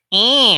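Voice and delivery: high, loud, bright twanged "eh"; sudden bright pharyngeal bleat. Shown as a demonstration. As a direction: blurt a demo "eh" in cartoon twang. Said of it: "you do that sound".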